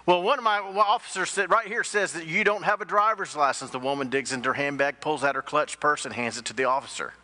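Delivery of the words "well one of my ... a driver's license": higher-pitched, thrown-off questioning; mildly acted bewilderment; stunned sergeant. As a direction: play an officer taken aback, voice raised in pitch.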